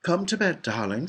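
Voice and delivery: lightly voiced mum calling to bed; a hint of motherly singsong, starting high; gentle mother. A narrator's reading. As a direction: coax a child with a lilt. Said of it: "said Charlie's mother".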